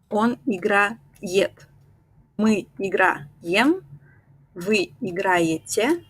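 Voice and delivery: slow, careful conjugation; slow drill. Shown as a demonstration. Teacher walks students slowly through each form. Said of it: "slow conjugation drill".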